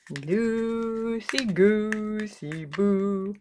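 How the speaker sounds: very slow, stretched silly rhyme; playful sing-song. Real, not acted. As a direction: drawl it out like a playful chant.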